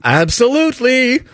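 high, drawn-out, over-the-top agreement; hammed-up obliging tradesman, slow and high; eager milliner. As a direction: sing out a gushing yes, climbing high. Acting out the milliner's eager reply.

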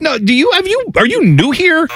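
high-pitched incredulous challenge. Acted. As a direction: question them in mock disbelief, pitch shooting up. Mock reply to fans' naive trades.

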